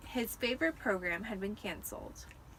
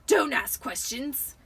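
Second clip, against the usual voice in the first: slow, louder uncle's snap; snapped; 3 semitones higher, 7 dB louder, slower than usual. A narrator's reading. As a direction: cut him off hard. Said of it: "snapped his uncle".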